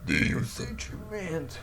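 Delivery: words burped out, high and slow; burp talking. Real, not acted. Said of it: "Eu sei falar arrotando também".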